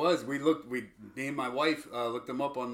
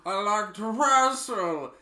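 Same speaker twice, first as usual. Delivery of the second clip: slow, put-on oddball voice; weird character voice; 5 semitones higher, 5 dB louder, slower than usual. Mocking Steve Carell's Foxcatcher voice, slow and odd. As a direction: deliver it slow and strange. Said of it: "making up a character".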